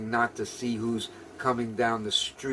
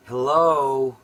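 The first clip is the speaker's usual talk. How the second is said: loud, higher calling hello; ugly hello; 4 semitones higher, 10 dB louder, slower than usual. Retold or imitated. Impatient customer calling the waiter, loud ugly hello. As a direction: hail the waiter rudely. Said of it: "he said".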